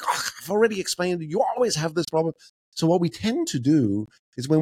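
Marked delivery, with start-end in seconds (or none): 0.0-2.3 higher, put-upon sigh of a voice; exasperated. Retold or imitated. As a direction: brush off the question, fed up. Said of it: "and you're like".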